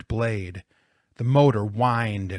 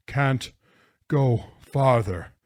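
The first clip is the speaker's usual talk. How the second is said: broken words between long pauses; gasping; 2 semitones higher, 3 dB louder, slower than usual. A narrator's reading. Jonathan stopping with a pain in his side, gasping. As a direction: force out each word through a stitch. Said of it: "he gasped".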